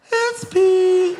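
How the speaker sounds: high, smug giggly reveal; cocky kid. Retold or imitated. Boy reveals the squirt gun secret, high smug. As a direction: grin and spill the secret. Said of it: "And I go".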